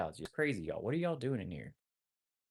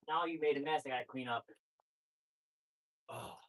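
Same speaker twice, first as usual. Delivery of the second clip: ordinary talk, nothing marked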